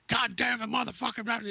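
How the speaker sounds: high-pitched mock angry cursing; hammed-up cursing tirade trailing into blahs; pissed-off rant. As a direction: imitate a furious friend cursing away. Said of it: "He's all pissed off. He's like".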